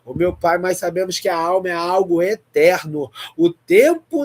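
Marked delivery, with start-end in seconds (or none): none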